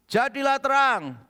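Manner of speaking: high, loud divine proclamation; the voice of God, booming; booming command. Acted. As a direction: call it out like God commanding light. God's creative command, replayed.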